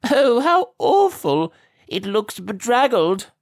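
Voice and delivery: high, slow, theatrical sneering lady; laughing mockery. A narrator's reading. Cruel lady mocks the muddy girl, high and slow. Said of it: "laughed the lady".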